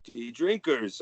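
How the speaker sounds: ordinary talk, nothing marked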